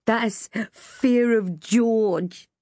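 lightly voiced, slow boyish tease; teasing boy. A narrator's reading. George explaining his own joke, teasing. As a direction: draw out the joke very slowly.

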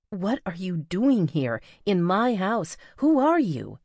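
slow, building to a high loud cry; rising alarm. A narrator's reading. Sierra confronting the intruder in her house, rising alarm. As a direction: panic pushing through each question. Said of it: "she asked".

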